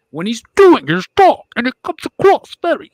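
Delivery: loud, booming villain impression; Bane. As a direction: put on the masked villain's voice, far too loud. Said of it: "very loud and just not".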